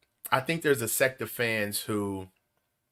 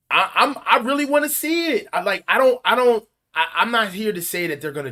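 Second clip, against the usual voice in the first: excited; 11 semitones higher, 6 dB louder than usual. Real, not acted. Excited sports host fumbling words.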